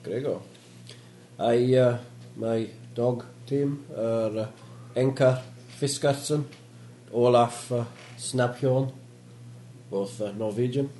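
put-on halting, slow Polish-accented speech.

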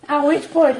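normal talking voice

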